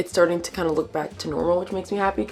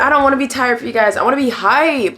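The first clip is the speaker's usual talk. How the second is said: loud and high; 7 semitones higher, 8 dB louder than usual. Real, not acted. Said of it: "Sorry, I'm so tired".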